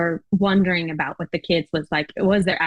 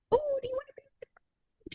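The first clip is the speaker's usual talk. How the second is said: put-on much higher, softer whispered kid voice; imitating Kiwi kids; 10 dB quieter than usual. Kiwi kids inviting her children in, a high whispered kid voice. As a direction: hush it like a shy child asking to be friends. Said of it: "Kiwi kids just kind of in bold are like".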